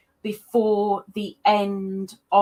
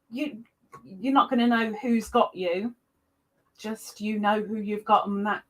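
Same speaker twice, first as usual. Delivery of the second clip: ordinary talk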